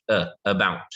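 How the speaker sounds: "about" opened with a weak, low "uh"; schwa sound. Shown as a demonstration. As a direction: say "uh", then uh-BOUT for "about". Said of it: "which is like the er".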